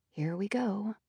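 soft muttered aside; mumbled under breath. A narrator's reading. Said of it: "she mumbles something under her breath".

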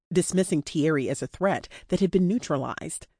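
ordinary delivery, nothing marked

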